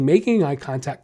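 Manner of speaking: usual speaking voice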